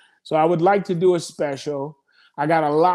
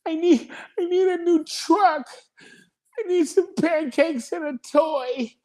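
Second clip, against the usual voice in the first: put-on much higher, whiny little kid listing what he needs; imitating his little son; 12 semitones higher than usual. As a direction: play the four-year-old begging Daddy for treats, high-pitched. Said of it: "he be telling you what he need".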